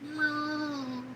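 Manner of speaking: high soft mewing; whiny cat meows. Retold or imitated. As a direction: mew softly like a cat who wants down. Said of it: "she'd be like".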